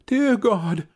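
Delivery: high, loud desperate plea; crying girl. A narrator's reading. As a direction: beg God in despair. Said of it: "she cried".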